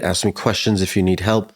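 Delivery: usual speaking voice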